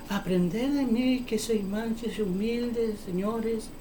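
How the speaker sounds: parodia de prédica mansa, lenta y bajita; Cristo. Pretend. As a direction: recita despacio y muy suave, fingiendo mansedumbre. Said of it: "¿Tú crees que Cristo estaba hablando así?". (voz mansa fingida, lenta y bajita)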